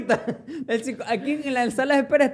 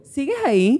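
mock Netflix prompt; 4 semitones higher than usual. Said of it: "la pregunta del cerebro de Gigi".

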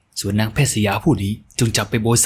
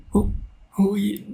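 high, trembling call of a beaten maid; quavering cry for help; 11 semitones higher, much slower than usual. A narrator's reading. Maid under the cane crying to her young mistress for pity. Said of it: "เสียงสั่นเครือเรียกร้องเพื่อขอความเห็นใจ".

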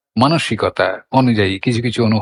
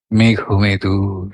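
মেঘ মেদুর low, slow and evenly drawn out; soft, unstressed glide; 5 semitones lower, much slower than usual. Shown as a demonstration. Reciter showing the feeling of the word, soft unstressed glide on মেঘ মেদুর. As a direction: let মেঘ মেদুর glide slowly in a low register, no stress. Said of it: "মৃদু ঝোঁকও নেই".

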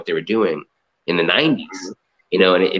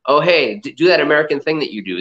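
mock eager request; 7 semitones higher than usual. Locals urging the American to perform, higher eager mock voice. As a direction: chirp it out like a delighted fan wanting a show. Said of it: "it's kind of like".

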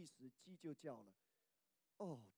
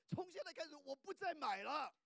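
raised, louder determined pledge; mock vow; 10 semitones higher, 6 dB louder than usual. Preacher mimics sisters making a firm vow. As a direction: swear it off with big resolve. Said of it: "有一些姐妹说".

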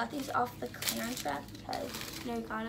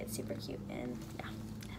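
whispering; 12 semitones lower, 8 dB quieter than usual. Real, not acted. Whispering quietly while filming.

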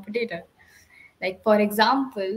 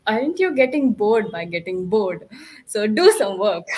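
self-scolding; 2 semitones lower than usual. Retold or imitated. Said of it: "I used to ask myself".

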